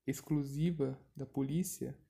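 ordinary delivery

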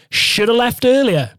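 high, loud, slow mock reproach; dad-like scolding. Friend scolding a late passenger, loud drawn-out mock reproach. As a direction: imitate a preachy telling-off, stretched out.

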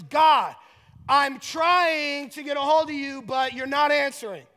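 loud, high-pitched complaint to God; impassioned acted prayer of exasperation; frustrated plea. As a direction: plead urgently, leaning hard on key words. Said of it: "put that in layman's terms".

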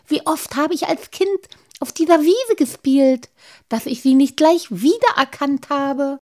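overflowing with homecoming joy; eager rapture. Acted. Halbschuh tells his friends this is his childhood land, eager. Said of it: "fuhr Halbschuh eifrig fort".

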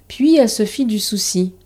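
usual speaking voice

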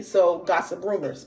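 normal talking voice

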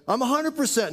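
a retold happy shout-out; joyful announcement. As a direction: lift it up with delight. Healed man's joyful report.

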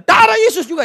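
very high, loud mock cry; mimicked alarmed exclamation. Preacher mimics friend as pedicab overtakes them, high shout. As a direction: shriek it in mock-pious alarm.

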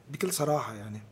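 ordinary delivery

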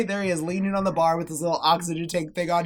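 ordinary talk, nothing marked

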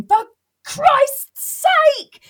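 very high, loud, slow ranting yell; exasperated stage shout. Pretend. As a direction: bellow at the audience in despair. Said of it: "standing on a stage going".

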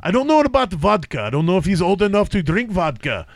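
put-on comic Russian accent. Host joking about bear-fighting players, Russian accent. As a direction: play a vodka-loving Russian, dropping the articles. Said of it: "Three is Russian".

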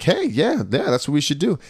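mock approval. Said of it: "a lot of people were like".

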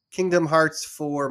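ordinary delivery

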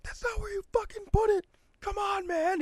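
very high strained scream without the volume; held-back mock scream. Shopper exasperated at family member, held-back screaming. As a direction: imitate screaming, keeping the level down. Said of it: "But I'm screaming".

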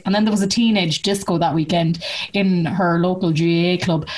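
ordinary talk

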